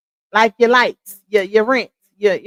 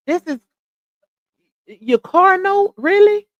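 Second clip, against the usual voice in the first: incredulous; 8 semitones higher than usual. Real, not acted. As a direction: stretch it out in disbelief. Disbelief at paying car note.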